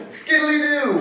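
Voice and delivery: a high, loud sing-song skiddly-doo; kids' mock cheer. Kids cheering as dad announces the trip, excited high chant. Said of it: "And we would go".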